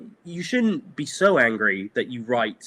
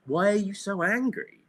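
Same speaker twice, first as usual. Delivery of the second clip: higher, baffled asking; puzzled plea; 8 semitones higher than usual. Pretend. Talking to everyone on the internet, baffled plea. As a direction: gently ask them why they're furious. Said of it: "I would just go".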